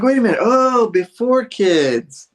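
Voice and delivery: loud, high, drawn-out "oh, I get it"; hammed-up sudden understanding; dawning realization. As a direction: exclaim as the penny drops, stretching it out. Acted sudden realization in retold scene.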